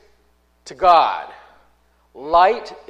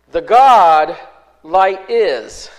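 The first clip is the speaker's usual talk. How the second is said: "The God light is" punched much louder; Greek word order emphasis; 8 dB louder, much slower than usual. Shown as a demonstration. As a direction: hit "The God" hard, then "light is". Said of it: "he's emphasizing".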